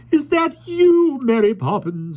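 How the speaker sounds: high, loud slow cry. A narrator's reading. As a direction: call out in a high fluster. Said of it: "she cried".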